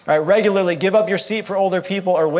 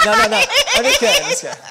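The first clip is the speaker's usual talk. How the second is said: much higher, louder laughing no no no; laughing backpedal; 6 dB louder, faster than usual. Real, not acted. Speaker laughing after jokingly calling someone on stage, backpedalling. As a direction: laugh off the joke you just made. Teasing audience about public speaking.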